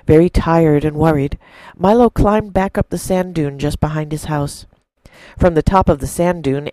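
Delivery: a preacher narrating a parable to the church, in an expressive storytelling voice; story narration. A narrator's reading. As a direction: tell it like a fireside story to the congregation. Sermon told as a story.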